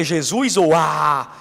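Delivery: drawn-out, unsure question; slow hesitant musing. Retold or imitated. Convert deciding what to shout, drawn out slowly. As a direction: stretch it out while deciding.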